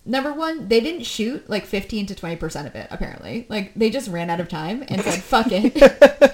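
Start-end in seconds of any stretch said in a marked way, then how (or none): 5.2-6.3 loud mock "we're done" outburst; loud giving up. Imitating filmmakers who ran out of time, a loud dismissive blurt. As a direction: blurt it out much louder, imitating a crew quitting.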